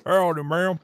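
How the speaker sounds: loud mock cowboy accent; hammed-up cowboy greeting. Shown as a demonstration. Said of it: "say it in the bloody accent".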